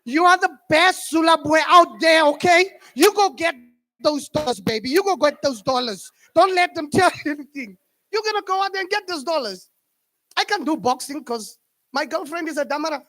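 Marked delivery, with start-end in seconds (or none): 0.0-9.7 hyped-up pep talk. Retold or imitated. Girlfriend cheers her boxer to go win, hyped and loud. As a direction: pump him up like a cheerleader.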